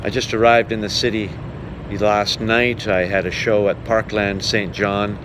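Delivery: ordinary talk, nothing marked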